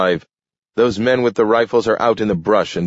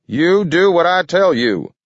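high, loud furious insistence; screaming order; 12 semitones higher, 5 dB louder than usual. A narrator's reading. Mayor overrules Maxwell on the radio, screaming. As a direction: scream down the objection. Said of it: "screamed the mayor".

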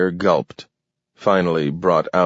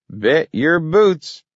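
put-on high, slow folksy old man; 10 semitones higher, 3 dB louder, slower than usual. A narrator's reading. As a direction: drawl it like a cocky old-timer.

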